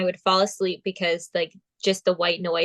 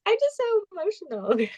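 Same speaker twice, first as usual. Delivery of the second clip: much higher, teary gush; gushing emotional; 12 semitones higher than usual. Retold or imitated. Contestant answering her boyfriend while crying onstage, high gushing voice. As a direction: squeal it out, overwhelmed with happy tears. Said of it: "But I'm like".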